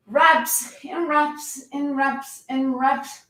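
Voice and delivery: slow, stretched repetition; drawn-out reps. Shown as a demonstration. Trainer mocks pointless endless reps, slow and dragging. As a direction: drag each reps out wearily. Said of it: "mocking endless reps".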